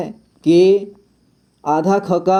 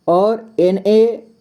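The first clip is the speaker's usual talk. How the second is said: slow spelling; much slower than usual. Shown as a demonstration. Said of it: "spelling out slowly".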